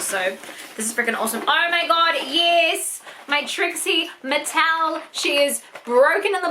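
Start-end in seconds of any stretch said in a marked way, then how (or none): none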